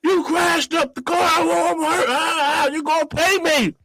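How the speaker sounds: high-pitched acted yelling; retold yelling. Injured passenger at driver, high mock yelling. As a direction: holler the demand way up high. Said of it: "I'm yelling at him".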